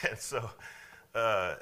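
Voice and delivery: ordinary talk, nothing marked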